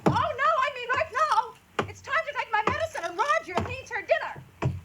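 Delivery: high, nagging complaint; shrill invalid wife. Acted. Invalid wife nagging her husband, high and whiny. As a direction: whine at him from the sickbed. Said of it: "his wife shouted at him".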